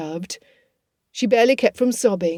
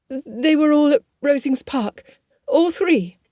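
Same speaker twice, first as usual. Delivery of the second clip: higher, trembling with shock; shaking voice; 7 semitones higher than usual. A narrator's reading. Elizabeth to the Colonel, realizing the truth, shaken. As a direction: voice quivering as the truth lands. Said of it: "Elizabeth's voice shook".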